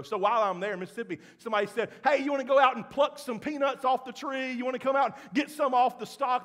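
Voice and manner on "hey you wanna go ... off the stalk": higher, louder teasing offer; mocking tease. Mississippi friends teasing the pastor, mocking tone. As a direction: bait the city boy with a grin. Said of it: "They're trying to make fun of me".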